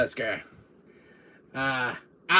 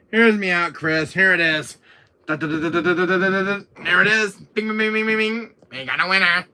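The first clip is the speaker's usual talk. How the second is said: playful fanfare; 4 semitones higher, 7 dB louder than usual. Pretend.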